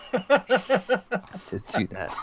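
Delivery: soft, slow, laughing aside; laughing hushed confession. Acted. Client admits it to lawyer, laughing and hushed. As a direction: repeat the confession softly through laughter.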